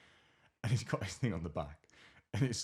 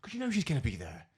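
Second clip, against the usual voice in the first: higher, exasperated mimicked complaint; aggravated; 6 semitones higher, 3 dB louder than usual. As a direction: imitate her fretful phone rant.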